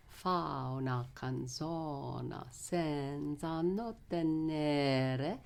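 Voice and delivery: slow, carefully stressed Italian; slow spoken Italian, stress marked; Italian text spoken. Shown as a demonstration. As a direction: speak the Italian with clear syllable stress. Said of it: "you can teach in the stress".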